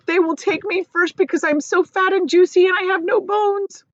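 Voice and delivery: high, loud frightened wail; terrified earthworm. Acted. Earthworm fearing he is eaten first, high wail. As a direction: wail out the fear.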